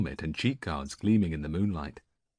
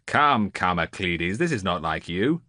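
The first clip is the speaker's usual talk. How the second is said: louder, with a firm edge of anger; 5 dB louder than usual. A narrator's reading. Odysseus chiding the reluctant seer, with an edge of anger. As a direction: push back at him, a bit irritated. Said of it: "an edge of anger in his voice".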